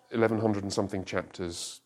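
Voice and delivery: normal talking voice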